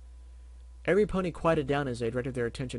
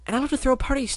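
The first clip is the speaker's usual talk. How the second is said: much higher and louder, bubbly; Pinkie voice; 13 semitones higher, 7 dB louder, faster than usual. Acted. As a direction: squeal it out like Pinkie. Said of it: "Pinkie answers".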